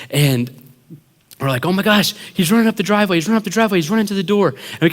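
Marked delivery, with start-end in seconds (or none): 1.7-4.5 fast, high-pitched fake alarm; mock panic. Pranksters faking an intruder, high urgent panic. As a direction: rattle it off in pretend panic.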